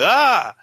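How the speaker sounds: loud high shriek of fright, acted out; mock startled shriek. Showing how scared Zach got, a high shriek. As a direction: yelp like you just got spooked. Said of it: "but he was like".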